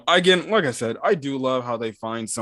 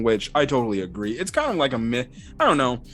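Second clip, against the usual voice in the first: normal talking voice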